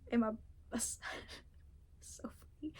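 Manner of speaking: breathy whisper, slowed, through laughter; a private giggle under the breath, slowly. Real, not acted. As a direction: whisper it, barely holding in a laugh. Laughing aside.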